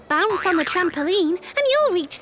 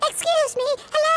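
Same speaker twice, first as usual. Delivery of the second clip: squeaky mouse voice; 8 semitones higher, faster than usual. Acted. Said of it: "Olive heard a squeaky little voice".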